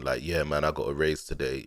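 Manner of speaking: low, dead flat, no lift at all; monotone no emotion. Retold or imitated. Mocking a monotone guy who got a raise, dead flat. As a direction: deliver good news with zero excitement. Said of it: "Someone just always sounds like this no matter what".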